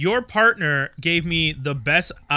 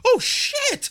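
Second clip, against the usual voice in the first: shocked. Retold or imitated. Retold shocked reaction.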